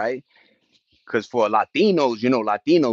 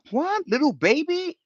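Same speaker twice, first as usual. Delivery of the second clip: much higher what, then an incredulous repeat; shocked; 14 semitones higher than usual. Real, not acted. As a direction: react in real disbelief. Shocked reaction to the news.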